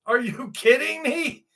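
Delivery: high, scoffing disbelief; a laughing, high-pitched are you kidding me; incredulous retort. Retold or imitated. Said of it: "you're gonna say".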